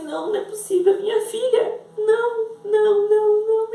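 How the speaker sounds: high, loud anguished denial, close to crying; retold tearful denial. Mother pleading to the sky about her daughter, tearful denial. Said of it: "olhando pro céu e chorando".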